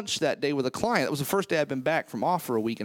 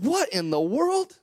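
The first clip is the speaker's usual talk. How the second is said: higher, slow startled wake-up; 10 semitones higher, slower than usual. Retold or imitated. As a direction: snap out of a daze in disbelief. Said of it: "I said".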